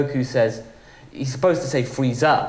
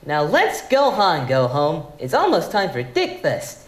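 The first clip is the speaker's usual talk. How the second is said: lightly voiced Goku, a touch of laughter; Goku line; 2 semitones higher than usual. Voice actor recalls his Goku line, lightly voiced. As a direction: read it as the cheerful hero. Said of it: "it was the line".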